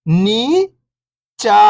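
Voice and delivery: first syllable lifting, pause, second falling. Shown as a demonstration. Teacher breaking a word down for beginning readers, syllable by syllable. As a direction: sound out each syllable alone, long and separated. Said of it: "एक अक्षर का उच्चारण करके".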